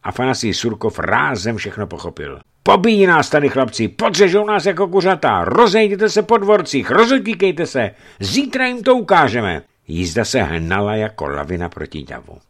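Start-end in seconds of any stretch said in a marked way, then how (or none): 2.6-9.6 loud, high, urgent call to the crowd; high alarmed shouting; urgent warning shout. Acted. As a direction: yell at them to scatter and run. Surkov warns the crowd.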